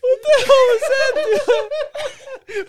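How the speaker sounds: high, crying with laughter; gasping through wild laughter; laughing hysterically. Real, not acted. Helpless laughter after outro.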